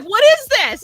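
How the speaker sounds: loud, high, incredulous mock demand; retold outburst. Wife questioning husband about the pie, loud disbelief.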